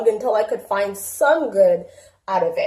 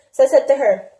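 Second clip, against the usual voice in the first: ordinary talk, nothing marked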